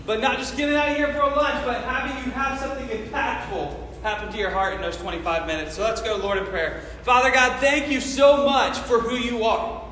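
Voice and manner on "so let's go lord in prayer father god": ordinary talk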